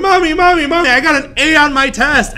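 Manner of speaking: put-on high, eager kid calling Mommy; a thrilled little kid yelling his news home; excited child.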